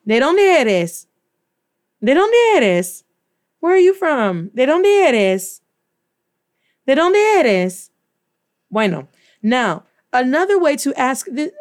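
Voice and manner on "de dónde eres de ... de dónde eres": sung-out question, higher, stress on dónde; suavecito. Shown as a demonstration. Teacher models the smooth musical question for students. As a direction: melodic like music, vowels leading. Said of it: "Now make it suavecito".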